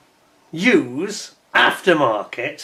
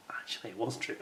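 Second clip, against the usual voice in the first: whispered aside; 4 semitones lower, 19 dB quieter, faster than usual. Acted. Confessing aside after a denial.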